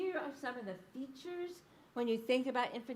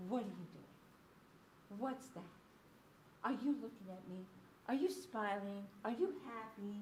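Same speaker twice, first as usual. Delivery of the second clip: infant-directed speech; 6 dB quieter than usual. Shown as a demonstration. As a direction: coo simple questions to an infant. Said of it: "much shorter utterances".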